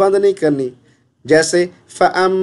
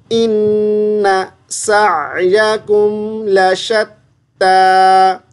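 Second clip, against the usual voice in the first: slow melodic tilawat chant; Quran recitation; 3 semitones higher, much slower than usual. Shown as a demonstration. Teacher recites the verse for the student, slow tilawat. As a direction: recite it slowly, drawing out each vowel.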